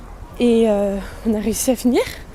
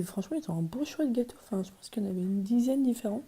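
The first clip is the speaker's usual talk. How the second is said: whispering; 7 dB quieter than usual. Real, not acted. In bed at night.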